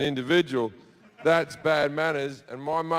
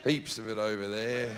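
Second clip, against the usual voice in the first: laughing; 7 semitones lower, 8 dB quieter than usual. Real, not acted. Laughing at own lie.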